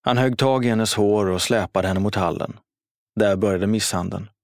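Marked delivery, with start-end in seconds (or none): none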